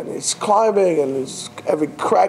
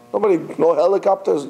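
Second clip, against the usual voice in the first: normal talking voice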